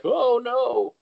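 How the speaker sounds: high, loud wail of "Oh, no!"; dismayed oh no. Retold or imitated. Recounting the group split-up, high loud dismayed wail. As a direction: wail it as everything falls apart. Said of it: "It's like".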